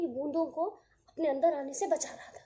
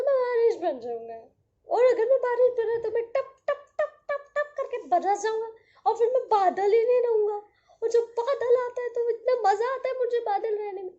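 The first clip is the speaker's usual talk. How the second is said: loud grumpy cloud refusing entry, fast; a cloud barking at the droplets to keep out; grumpy cloud shouting; 5 semitones higher, 6 dB louder, faster than usual. Acted. Cloud explaining why.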